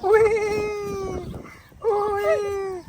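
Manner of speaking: high, drawn-out whee; playful squeal. Real, not acted. Owner playing with pets, high playful squeal.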